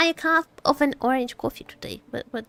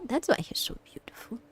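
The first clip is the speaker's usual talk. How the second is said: soft unvoiced whisper, much softer; swooning whisper; 14 dB quieter than usual. Real, not acted. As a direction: breathe it out like a smitten fan. Swooning over the voice actor.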